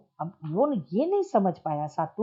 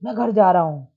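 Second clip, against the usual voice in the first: angry; 2 semitones lower, 6 dB louder, faster than usual. Shown as a demonstration. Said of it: "गुस्से में उसने क्या बोला?".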